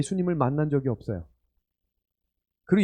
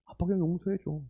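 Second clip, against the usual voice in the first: put-on soft, small child's voice asking to forgive; little son; 3 semitones higher, 5 dB quieter than usual. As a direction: play the boy gently. Son's words quoted by father. (아들 목소리로 부드럽게)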